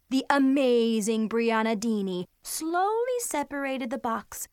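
plain reading voice

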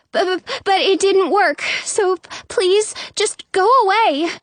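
high, stammering plea; frightened, near tears. A narrator's reading. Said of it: "as if she's frightened or about to cry".

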